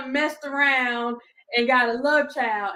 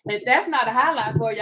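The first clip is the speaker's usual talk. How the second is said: ordinary talk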